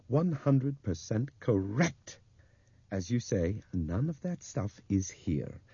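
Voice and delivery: slow, deliberate confirmation. Acted. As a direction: weigh each word slowly. Bob confirms slowly.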